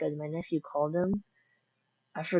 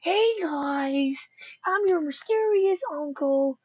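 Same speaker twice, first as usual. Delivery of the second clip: much higher, put-on goofy uncle voice; surprise uncle; 11 semitones higher, 4 dB louder than usual. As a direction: pretend to be a cheery mystery uncle popping in. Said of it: "be like".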